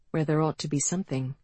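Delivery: ordinary delivery, nothing marked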